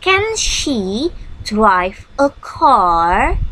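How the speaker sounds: Can she drive a car said very slowly with a rising tune; slow and clear teacher model question. Shown as a demonstration. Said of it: "Seperti ini contohnya".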